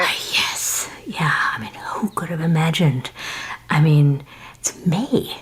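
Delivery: breathy hushed whisper on the phone; whispering so the daughter won't overhear. Acted. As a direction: keep it secret, just air. Hiding talk from daughter.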